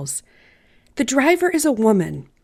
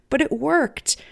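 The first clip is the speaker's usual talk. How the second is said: ordinary delivery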